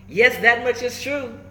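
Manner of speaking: bright, sing-song rhyme reading; storybook read-aloud. A narrator's reading. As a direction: read it out like the book page.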